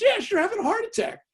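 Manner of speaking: high, loud mock-alarmed nurse; imitated yelling. Nurse confirming the heart attack to her patient, imitated high yell. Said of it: "she's yelling and shouting".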